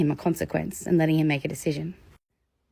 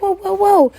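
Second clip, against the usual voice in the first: much higher, louder whoa; startled kid; 14 semitones higher, 7 dB louder than usual. Retold or imitated. Child startled by the adult's panic, high whoa. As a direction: back off startled.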